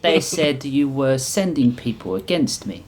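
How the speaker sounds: put-on, loosely Russian accent; vaguely Russian accent. The Reap confronts her visitors, vaguely Russian accent. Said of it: "thick veil accent that was vaguely Russian".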